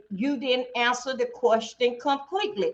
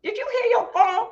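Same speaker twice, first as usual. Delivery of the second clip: acted wife; 8 semitones higher than usual. Wife voicing her question.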